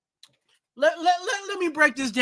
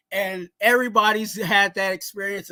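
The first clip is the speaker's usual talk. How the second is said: ordinary talk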